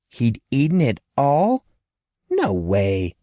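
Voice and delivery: ordinary delivery, nothing marked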